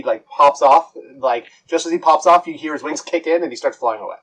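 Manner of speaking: ordinary talk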